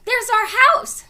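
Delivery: high loud pointing out; thrilled discovery; excited. A narrator's reading. Annie spotting their house from the treehouse, excited.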